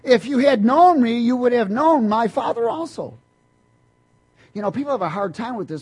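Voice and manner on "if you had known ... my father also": loud, high ringing scripture proclamation; preacher booming the verse to the congregation; preaching at full force. Acted. Preacher proclaiming the verse loudly to the church.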